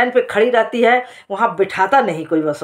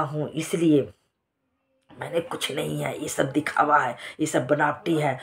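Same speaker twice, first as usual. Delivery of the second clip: much softer, hushed confiding of secrets; softer conspiratorial aside, as if afraid of being overheard; secretive confession; 4 semitones lower, 5 dB quieter than usual. Acted. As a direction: drop the voice so nobody overhears. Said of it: "अरे, धीरे धीरे बोल, कोई सुन ना ले".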